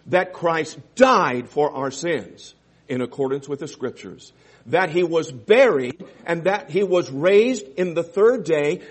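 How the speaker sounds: full-force preaching. Acted. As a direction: drive each phrase home from the pulpit.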